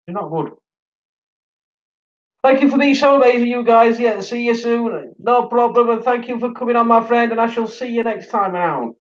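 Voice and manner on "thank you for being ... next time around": high, bright goodbye to a guest; cheery sign-off. Real, not acted. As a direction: sing out a cheerful farewell.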